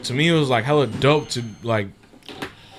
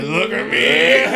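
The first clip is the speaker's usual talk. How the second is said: put-on drunk showing off; 8 semitones higher, 7 dB louder, much slower than usual.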